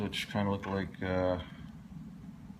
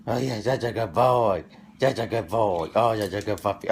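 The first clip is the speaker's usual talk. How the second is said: loud dog praise; 9 dB louder than usual. Real, not acted.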